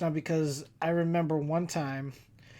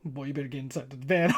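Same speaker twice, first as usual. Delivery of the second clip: stern aunt; 4 semitones lower, 6 dB quieter, faster than usual. Retold or imitated. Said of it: "like the meanest look".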